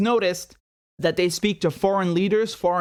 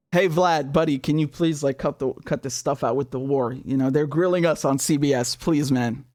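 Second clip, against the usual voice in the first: pretend pleading call; 6 semitones lower than usual. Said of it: "What do you think that conversation goes like?".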